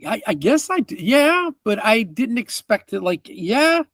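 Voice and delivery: flustered merchant. Acted.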